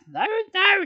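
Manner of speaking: very high, loud mother's chiding; high patient mum voice. Acted. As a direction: gently correct the baby. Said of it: "she said patiently".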